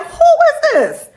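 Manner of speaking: high, loud and slow, a sharp demand; yelling into the phone at a stranger; demanding who answered. Retold or imitated. Angry call to her own house.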